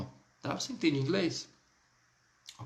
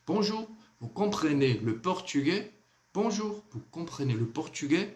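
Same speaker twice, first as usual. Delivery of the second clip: French question with "portugais" rising at the end; question intonation; 3 semitones higher than usual. Shown as a demonstration. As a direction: ask "vous comprenez le portugais?" by tune alone, lifting the end. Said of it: "Essa é a minha forma".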